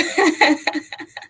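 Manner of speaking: bright amused laugh; a real burst of laughter. Real, not acted. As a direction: laugh at the memory. Laughing at own story.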